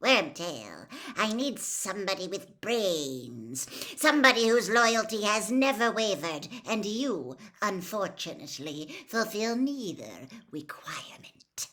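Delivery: lightly voiced cold, commanding lord; calm menace handing out orders; a dark lord instructing his servant; cold master. A narrator's reading.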